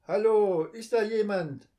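loud, high call into the dark; calling out again. A narrator's reading. Boy calls again to see if anyone is aboard. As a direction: shout it toward the lit cabin. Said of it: "ruft Lukas".

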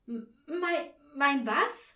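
high, loud, flustered stammer; stuttering. A narrator's reading. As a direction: trip over the first sound in confusion. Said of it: "stotterte Frau Schilling".

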